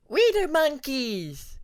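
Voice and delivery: put-on high, slow, sing-song little kid voice; a tiny dragonet chanting with glee; excited child cheer. A narrator's reading. Little son cheering to his father, high and slow.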